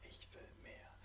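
soft whispered repeat; whispered echo. Shown as a demonstration. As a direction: whisper it back. Repeat-after-me affirmations.

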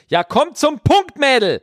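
high, slow mock impatience; impatient listener. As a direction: hurry her up in a shrill put-on voice. (ungeduldige Zuhörerin, hoch und gedehnt)

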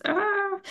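high, breathy sigh; exasperated sigh. Retold or imitated. Vlogger recalling card trouble with a high soft sigh.

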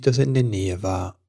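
plain reading voice

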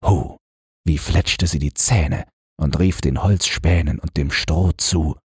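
usual speaking voice

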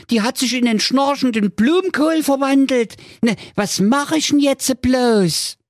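put-on high-pitched fretful young prince; a pampered prince in a panic; whiny high prince. Prince's first lament. (hohe, weinerliche Prinzenstimme)

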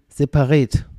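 separät said with a bright ä. Shown as a demonstration. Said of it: "müsste es ja richtiger heißen". (separät mit ä gesprochen)

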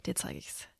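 low whispered vow; whispered threat. Retold or imitated. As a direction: hiss the threat under breath. Inner thought about rival.

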